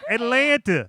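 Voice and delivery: slow, high mock-Southern accent; hammed-up Southern drawl. Shown as a demonstration. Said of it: "you do a Southern accent?".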